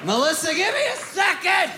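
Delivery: high mock shout to a coworker; stripper calling out. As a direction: yell for Melissa in a high squeal. Stripper calling out mid-scrape.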